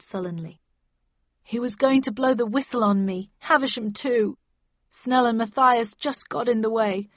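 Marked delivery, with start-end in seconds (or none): 1.4-7.1 acted wail, building higher and louder; sobbing confession. A narrator's reading. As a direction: whine it out in despair. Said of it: "he sobbed".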